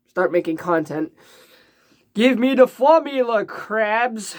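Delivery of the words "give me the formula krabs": lightly voiced cartoon villain; a hint of Plankton demanding. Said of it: "I can't do Plankton's voice".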